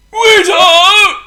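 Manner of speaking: very high, loud strained call; wheezy call. A narrator's reading. Overfed Potto calls the waiter, wheezy shout. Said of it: "he wheezed".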